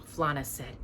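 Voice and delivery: usual speaking voice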